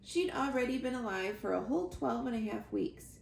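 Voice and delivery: ordinary delivery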